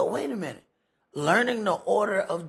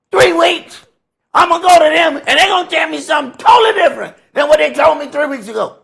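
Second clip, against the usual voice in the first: high, loud preaching shout, fast at the end; full-force preacher's cry about fickle people; emphatic shout; 8 semitones higher, 11 dB louder, faster than usual. Real, not acted. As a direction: hammer it home to the class. Preacher driving the point home.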